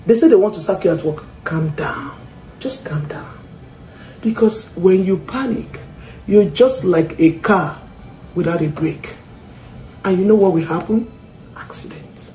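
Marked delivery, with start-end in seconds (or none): 1.4-3.3 softly whispered, soothing; whispered calm down. Shown as a demonstration. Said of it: "soothing the listener". 11.6-12.3 dramatic hushed whisper. Shown as a demonstration. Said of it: "dramatic whisper".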